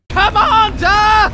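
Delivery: long drawn-out high shout; frantic commander call; alarmed shout. Acted. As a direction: yell it across a bridge in a crisis. Voice demo sci-fi alarm.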